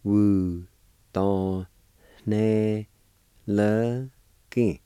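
"Wu, don, ne, le, ki" with a pause after each syllable. Shown as a demonstration. Teacher showing learners the word handed, syllable by syllable. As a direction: isolate each sound of "Wu, don, ne, le, ki". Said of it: "It's pronounced like this".

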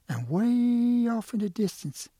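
"way" held long, high and loud; storyteller's sustained high note on "way"; stretched-out WAY. A narrator's reading. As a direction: draw out "way" to show great distance. Storyteller stretches word for distance.

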